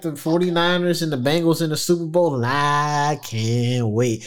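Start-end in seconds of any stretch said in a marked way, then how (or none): 2.5-4.2 slow, drawn-out wrestler promo voice; slow, over-the-top wrestler impression; Macho Man. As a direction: stretch each word like a wrestling hype man. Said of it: "get my Macho Man voice on".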